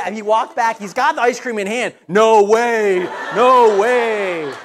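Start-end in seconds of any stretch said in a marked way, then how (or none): 2.1-4.5 drawn-out, awestruck repetition; imitated amazement. Son holding the free ice cream, mock amazed. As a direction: act out lingering disbelief.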